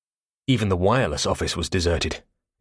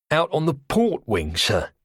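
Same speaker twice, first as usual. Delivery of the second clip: put-on cockney accent; 8 semitones higher, slower than usual. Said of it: "saturnine cockney".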